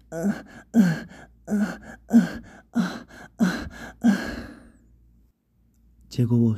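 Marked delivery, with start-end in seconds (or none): 0.1-4.2 high, louder short moans; acted moaning. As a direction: moan high in repeated bursts. (高声短促呻吟)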